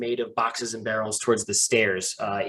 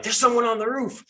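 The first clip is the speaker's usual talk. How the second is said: cry of alarm; 10 semitones higher than usual. Acted. Game master voices figures alerting each other to the archer, crying out. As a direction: call out an alarm in a raised pitch. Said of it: "there are more cries of".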